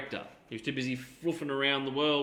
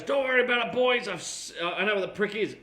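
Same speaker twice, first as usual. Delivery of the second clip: much higher, lightly Scottish mock Connery; Connery voice again; 8 semitones higher than usual. Connery telling the writers not to worry, light Scottish. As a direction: reassure the boys in a hinted Connery voice. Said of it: "he goes".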